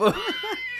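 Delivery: high, loud burst of laughter; a real shrieking laugh. Real, not acted. Mom cracks up at the memory, high shriek.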